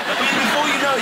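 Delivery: ordinary talk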